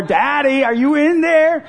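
high, loud imitation of a little girl; urgent child calling. Daughter banging on the bathroom door for Dad, imitated urgent calling. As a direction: call through a closed door, pitched well up. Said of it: "banging on the door".

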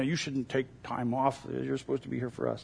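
low, soft aside quoting a note; quoted gripe, dropped in volume and pitch; muttered quoted complaint. Retold or imitated.

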